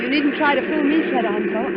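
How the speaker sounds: usual speaking voice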